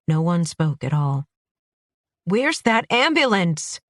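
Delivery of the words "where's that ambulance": high, urgent call for help; desperate shout. Acted. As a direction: yell it into the empty alley. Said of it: "I shouted into the silence".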